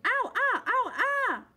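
high, loud pained cries; yelling in pain. A narrator's reading. Bear under the mosquito pile, high pained yelling. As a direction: yelp as the bugs bite. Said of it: "something was yelling".

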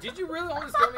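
high, laughing disbelief. Real, not acted. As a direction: ask it while cracking up. Laughing after near friendly kill.

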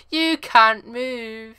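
P=A; taunting. Acted. Taunting trapped driver.

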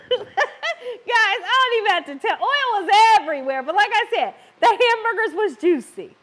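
bursting with amused disbelief; laughing. Real, not acted. Telling viewers how oily the stove got, laughing.

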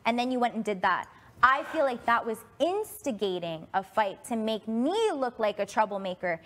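ordinary talk, nothing marked

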